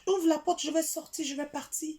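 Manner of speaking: high, insistent demand to leave; high-voiced retold protest; acted quarrel plea. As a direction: act out a man demanding to be let out, pitched high. Young man's quarrel acted out.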